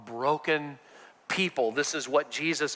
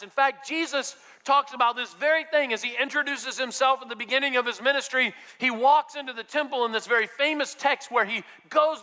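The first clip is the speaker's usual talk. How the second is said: higher, driving preacher's build; fervent preaching; 7 semitones higher than usual. Acted. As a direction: preach it with rising urgency.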